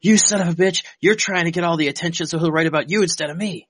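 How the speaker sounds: high, mock furious rant; angry outburst. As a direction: explode at the producer, hammed-up. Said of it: "Jones exploded at the producer".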